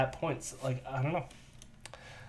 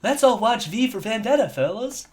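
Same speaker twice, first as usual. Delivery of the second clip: airheaded pundit, pitched higher; Dave Rubin; 7 semitones higher, 4 dB louder than usual. Acted. Retrying the pundit impersonation with more airheadedness, high and ditzy. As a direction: play up the airheadedness in a high put-on voice. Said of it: "needed a bit more airheadedness".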